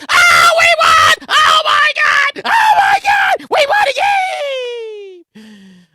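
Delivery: high, loud screaming joy; celebrating a win. Retold or imitated. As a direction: scream like the team just won.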